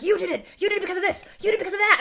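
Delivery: high mock yell of accusation; hammed-up, high-pitched accusatory shouting; imitated yelling. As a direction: imitate a movie detective hurling accusations. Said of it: "he's the one yelling".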